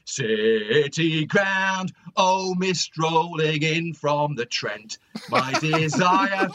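put-on slow, drawn-out chant in a Nottingham accent. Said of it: "in a sort of Nottingham accent".

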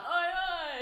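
loud laddish "oi oi"; lad shouting. Retold or imitated. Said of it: "like, shout to them".

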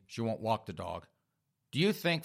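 usual speaking voice

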